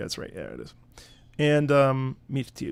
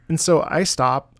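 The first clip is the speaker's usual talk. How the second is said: usual speaking voice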